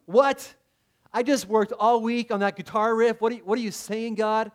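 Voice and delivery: high, loud, indignant disbelief; shocked protest. Retold or imitated. Guitar player protesting to God, shocked high outburst. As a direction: blurt it out as if your work was just insulted. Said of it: "the guitar player is going".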